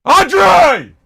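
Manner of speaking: high, full-throated shout for a family member; real calling-out yell with a long held ending; yell upstairs. Real, not acted. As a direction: holler the name up through the house. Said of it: "That's why I yell up to my family".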